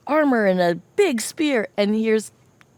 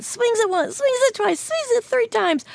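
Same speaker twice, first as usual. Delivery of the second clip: building excitement; 13 semitones higher than usual. Acted. Building slingshot swings.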